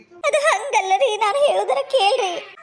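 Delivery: high, wavering wail of a plea; tearful pleading. Acted. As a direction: plead through acted tears, drawn out. Scolded wife pleads to be heard. (ಅಳುತ್ತಾ ಬೇಡಿಕೊಳ್ಳುವ ದನಿ)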